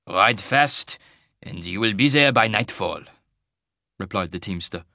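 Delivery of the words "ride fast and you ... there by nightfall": put-on slow, heavy Tarbanese accent; thick Tarbanese accent. Wagon master warning a traveller, in a thick Tarbanese accent. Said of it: "in a thick Tarbanese accent".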